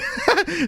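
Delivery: high-pitched giggle; laugh. Real, not acted. Reacting to the troll clip.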